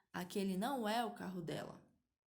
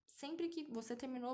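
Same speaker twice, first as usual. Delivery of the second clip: ordinary delivery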